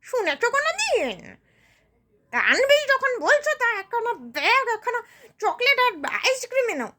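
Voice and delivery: sharp, swooping, sarcastic complaint; scornful poor woman. Acted. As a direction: scoff bitterly, then grudgingly challenge them to deliver. Poor woman scoffs at birthday celebration.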